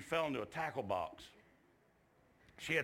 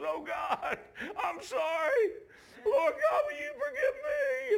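very high, loud crying plea; re-enacted sobbing cry to God; imitated tearful prayer; 6 dB louder, slower than usual. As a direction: wail a penitent prayer as the girl. Acts out the girl's tearful prayer.

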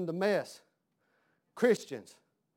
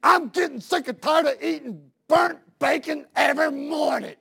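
high, loud husband's rant; angry yelling; 12 semitones higher, 11 dB louder, slower than usual. Acted. Husband griping at his wife, hammed-up furious shouting. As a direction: yell the complaint, stretching words for effect. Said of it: "he was yelling at his wife".

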